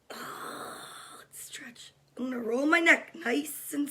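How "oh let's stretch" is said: hushed whisper. Real, not acted. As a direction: breathe it out quietly. Whispers before stretching.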